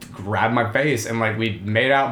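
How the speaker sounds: ordinary talk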